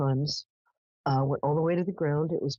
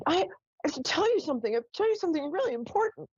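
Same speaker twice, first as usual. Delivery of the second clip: much higher, fast, insistent pleading; ghost's urgent plea; 11 semitones higher, faster than usual. Retold or imitated. Ghost insists to the teen she must tell her. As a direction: press the message like a desperate ghost. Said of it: "She said".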